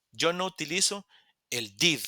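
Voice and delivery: normal talking voice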